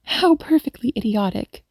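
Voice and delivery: lightly voiced annoyed exclamation; mild exasperation. A narrator's reading. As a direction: exclaim it with a touch of scorn.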